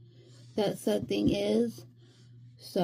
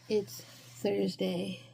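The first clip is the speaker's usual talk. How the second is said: ordinary delivery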